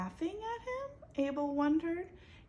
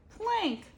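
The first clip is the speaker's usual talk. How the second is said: clank; 12 semitones higher, 5 dB louder than usual. A narrator's reading. Crash sound word.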